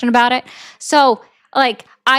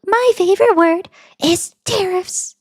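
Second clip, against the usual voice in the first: mock Trump in a much higher voice; high-pitched Donald Trump; 7 semitones higher than usual. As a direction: squeak out the boast in a put-on high voice. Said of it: "I was told that I have to do it in a high-pitched voice".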